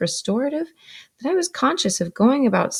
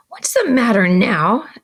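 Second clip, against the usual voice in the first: put-on slow, loud, sharp demand of a stern older sister; 4 semitones higher, 7 dB louder than usual. A narrator's reading. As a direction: snap the question out slowly and loudly. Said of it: "my sister smartly".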